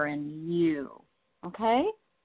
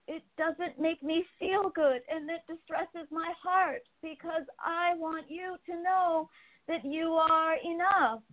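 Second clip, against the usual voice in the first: higher, pleading voice of the cat through the medium; gentle, higher channeled cat voice begging her to stop searching; speaking as the kitty; 11 semitones higher than usual. Acted. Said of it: "Your kitty says".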